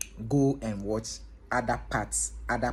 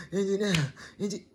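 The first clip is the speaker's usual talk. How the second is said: out of breath; 8 semitones higher, much faster than usual. Retold or imitated. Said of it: "trying to catch his breath".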